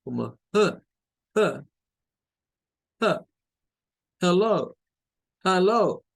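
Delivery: "hello" begun with "huh" in place of "heh"; UH first vowel. Shown as a demonstration. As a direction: swap the E of "hello" for an UH, "huh-LOH". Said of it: "se pronuncia como una U H".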